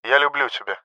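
soft loving murmur; tender last words. A narrator's reading. Pilot's last words to his beloved, softly tender. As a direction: say it quietly, as a last goodbye.

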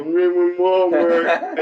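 slow, slurred mock simpleton voice; slow greeter. Comic imitating brain-injured ex-bully, slow mumble. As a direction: drag it out like a dazed greeter. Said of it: "They go".

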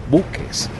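usual speaking voice

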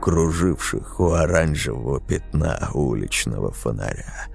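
ordinary delivery

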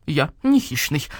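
ordinary delivery, nothing marked

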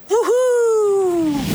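high, loud woohoo down the hill; puppy cheering on the sled ride; joyful cheer. Acted. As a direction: whoop with delight as you take off. Woezel sleds over the edge.

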